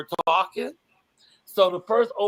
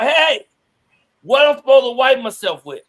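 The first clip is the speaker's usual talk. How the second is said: calling out; 5 semitones higher, 9 dB louder than usual. Retold or imitated. Hillbilly yelling from behind tree.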